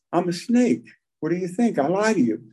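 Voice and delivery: high, smug snake with a laugh; mocking snake. Snake mocking the man it just bit, laughing. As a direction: gloat it, laughing at him.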